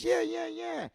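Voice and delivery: high, soft, knowing "yeah, yeah, yeah"; uncle's knowing yeahs. Retold or imitated. Uncle recognising the hat, high knowing chuckle-voice. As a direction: savour it like a crude old joker.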